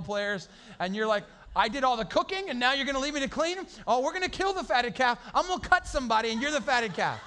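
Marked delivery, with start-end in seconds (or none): 1.5-7.2 louder, higher mock rant; fed-up cook ranting at family leaving her to clean; mock angry rant.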